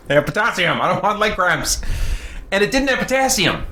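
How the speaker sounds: normal talking voice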